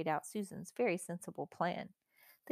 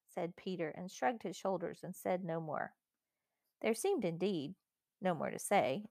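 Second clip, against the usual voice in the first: usual speaking voice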